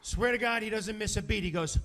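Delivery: normal talking voice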